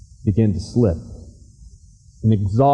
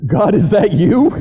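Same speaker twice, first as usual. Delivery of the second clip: startled; 9 semitones higher, 6 dB louder than usual. Acted. Will answers voice in dark.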